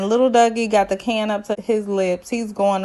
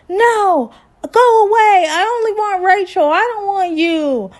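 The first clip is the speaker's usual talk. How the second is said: put-on high, loud upset child voice; whiny tween refusing his father; upset kid; 10 semitones higher, 5 dB louder than usual. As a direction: play a kid pushing his dad away.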